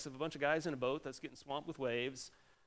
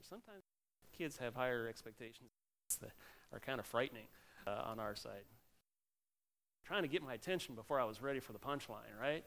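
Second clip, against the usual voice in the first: usual speaking voice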